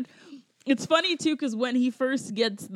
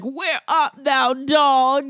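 Shakespearean actor; 3 semitones higher, 6 dB louder, much slower than usual. Retold or imitated. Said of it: "he does a Shakespearean thing".

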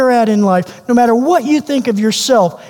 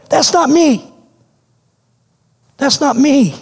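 voiced higher, pleading disbelief; a hint of an unworthy outsider protesting; protesting outcast; 4 semitones higher than usual. Outcast tells Jesus he has the wrong guy, higher and pleading.

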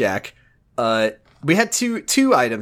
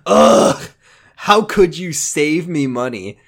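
mock pissed customer; 3 semitones higher, 4 dB louder, slower than usual. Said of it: "she was fucking pissed off and like".

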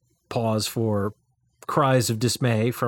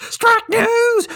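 high, loud, hammed-up "Strike news!"; mock news jingle shout; 6 dB louder than usual. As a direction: blurt it out like a cheesy news intro. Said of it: "I could, like, jump in and go".

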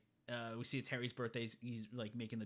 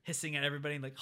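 ordinary delivery

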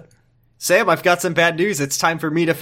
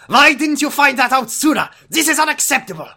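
much higher, put-on voice, indignant; hammed-up outrage at the misdiagnosis; high, hammy indignant doctor voice; mock furious doctor; 11 semitones higher, 4 dB louder than usual. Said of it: "He looked furiously".

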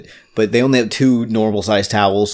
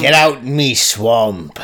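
put-on slow, drawn-out pirate-Scottish accent; hammed-up swamp-ogre accent; slower than usual. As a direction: drag it out in a mock pirate-Scot accent. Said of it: "your swamp accent".

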